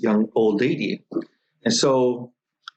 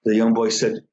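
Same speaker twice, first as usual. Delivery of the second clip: usual speaking voice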